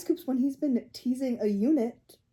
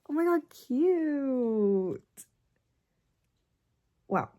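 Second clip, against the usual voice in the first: usual speaking voice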